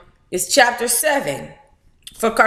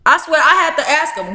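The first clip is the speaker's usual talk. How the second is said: usual speaking voice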